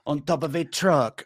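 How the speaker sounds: put-on slow, deliberate Belgian accent; thick, slow French-Belgian accent; Jean-Claude Van Damme. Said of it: "That's Jean-Claude Van Damme".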